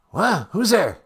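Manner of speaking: put-on startled, high, confused old politician; Joe Biden. President roused mid-call by the host, startled. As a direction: jolt awake, bewildered.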